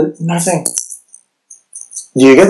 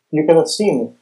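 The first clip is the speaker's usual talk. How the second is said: ordinary talk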